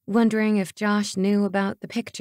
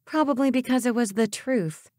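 dry; 4 semitones higher than usual. A narrator's reading. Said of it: "I remarked dryly".

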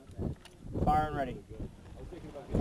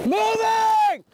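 callout shout; 10 semitones higher than usual. Real, not acted. Soldier calls movement on range.

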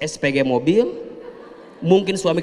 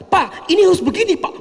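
pushy demanding wife; 12 semitones higher, 4 dB louder, faster than usual. Retold or imitated. Wife pushing her proposal on boss.

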